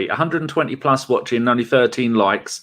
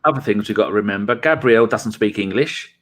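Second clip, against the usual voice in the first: ordinary talk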